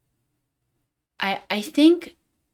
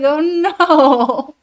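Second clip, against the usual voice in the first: much louder, higher, drawn-out "no"; mock dismayed wail; 7 semitones higher, 10 dB louder than usual. Retelling reaction to Spanish redeem, hammed-up loud wail. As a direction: wail it in exaggerated dismay, then break up. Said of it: "I was like".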